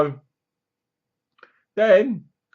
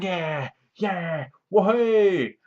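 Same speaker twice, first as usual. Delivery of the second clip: gleeful warden; 5 semitones higher, much slower than usual. Retold or imitated. Warden cheering on the beating.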